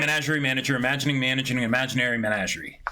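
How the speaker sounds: rapid-fire tongue twister; quick showy tongue twister; fast tongue twister. Shown as a demonstration. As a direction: rattle it off fast. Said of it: "Woo! Got it".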